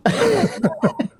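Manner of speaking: high laughing no. Retold or imitated. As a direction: laugh out the word. Kobe's teasing reply, laughing.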